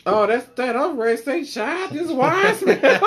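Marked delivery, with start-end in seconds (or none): 1.4-3.0 mock voice. Retold joke, high and slow.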